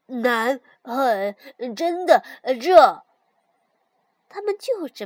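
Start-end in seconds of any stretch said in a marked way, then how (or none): 0.1-3.0 backwards speech. A narrator's reading. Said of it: "练习倒着说话".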